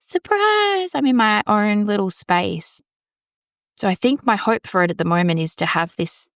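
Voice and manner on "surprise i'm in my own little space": high, bright surprise shout; spring the big reveal; announcing the new space with a high cheery surprise. Pretend. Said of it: "I can be like".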